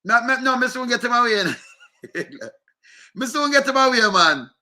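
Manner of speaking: laughing through the words. Real, not acted. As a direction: chuckle while mocking him. Laughing while mocking him.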